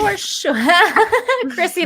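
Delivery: laughing while teasing. Real, not acted. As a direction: say it through a laugh.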